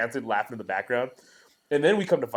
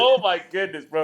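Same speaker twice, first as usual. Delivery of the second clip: laughing; 5 semitones higher, 4 dB louder than usual. Retold or imitated. Laughing retelling.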